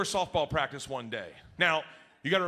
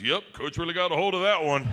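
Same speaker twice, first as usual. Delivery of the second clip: smirking; 4 dB louder than usual. Retold or imitated. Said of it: "smirks, and goes".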